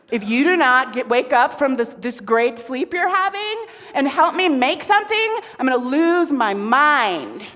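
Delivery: loud, rising ultimatum; exasperated threat. Acted. Shakti ordering Shiva to wake up, exasperated.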